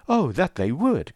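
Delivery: animated, slow and lifted in pitch; animated cry. A narrator's reading. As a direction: cry it out with eager longing, slowly. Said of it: "cried Marianne, her eyes sparkling with animation".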